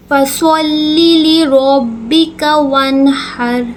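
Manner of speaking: slow melodic tajweed recitation, ghunna held on اِنَّا; Quran recitation. Shown as a demonstration. Teacher recites the verse for students, slow and melodic. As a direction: chant the verse slowly with long nasal hold. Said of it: "صاد کو ہم ہمیشہ پر کر کے پڑھتے ہیں".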